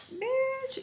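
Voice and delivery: very high, drawn-out quoted word; high-pitched retort. Retold or imitated. Retelling her comeback to Tommy, high-pitched retort.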